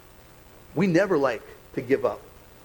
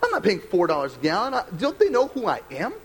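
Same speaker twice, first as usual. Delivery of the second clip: higher, self-important mock indignation, slowing on his own name; mock entitled rant; 8 semitones higher than usual. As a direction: play up your own spoiled outrage, hammed up. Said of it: "I'm like".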